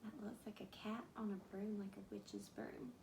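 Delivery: put-on breathy secret whisper to the kids; spooky whisper. Teacher points at spooky picture.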